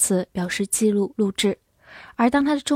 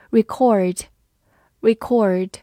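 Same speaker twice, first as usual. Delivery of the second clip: American "record", stressed on second syllable; American accent; much slower than usual. Shown as a demonstration. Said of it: "美式发音读作record".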